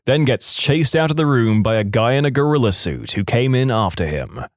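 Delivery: ordinary delivery, nothing marked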